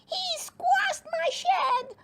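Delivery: high, slow indignant complaint; outraged little gnome; angry. Acted. Campion angrily complains to Cowslip about his shed. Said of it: "said Campion angrily".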